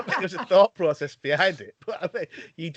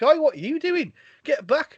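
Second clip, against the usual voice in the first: retold telling-off; 11 semitones higher than usual. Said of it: "I was like".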